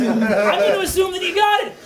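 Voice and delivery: self-satisfied put-on aside; pleased with himself. Clown figure after shooting you dead, pleased with himself. Villain talks over his victim.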